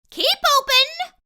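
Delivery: very high, loud squeaky yell; tiny dentist yelling a command; mouse yelling. A narrator's reading. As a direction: order the fox sharply. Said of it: "yelled Doctor DeSoto".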